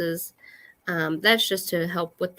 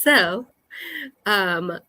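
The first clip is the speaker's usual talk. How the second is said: flight attendant voice; 3 semitones higher, 5 dB louder, much slower than usual. Shown as a demonstration. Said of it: "this is my flight attendant voice".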